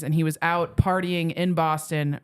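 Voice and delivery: usual speaking voice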